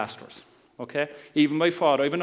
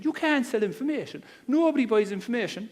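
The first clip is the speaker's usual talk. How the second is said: incredulous sceptic; 7 semitones higher than usual. Retold or imitated. Retold sceptic arguing with him.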